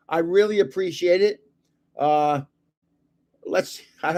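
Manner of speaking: normal talking voice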